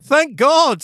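high theatrical thanks; relieved prince. A narrator's reading. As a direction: overdo the relief. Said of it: "said Prince Vasily".

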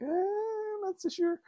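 very high squeaky "eh"; high-pitched, dubious reply; doubtful hedging. Retold or imitated. Doubting reply to his teacher.

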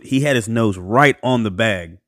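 ordinary delivery

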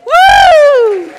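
high shouted woo; excited cheer. Real, not acted. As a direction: whoop with delight. Cheering for Nigeria.

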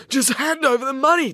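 high, urgent demand. Acted. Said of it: "demanded Maya".

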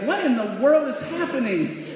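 retold exclamation of disbelief; astonished dad. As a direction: act out a baffled dad finding a mess. Dad finding the porch a mess.

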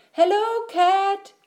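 very high hello; Duck calling out. A narrator's reading. Duck greets Cat while riding, calling out high. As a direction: hail someone in Duck's high voice.

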